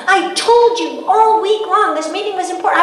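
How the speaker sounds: high, loud mock reproach; hammed-up spousal reprimand; acted scolding. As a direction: imitate an exasperated husband telling her off. Imagined scolding from the husband.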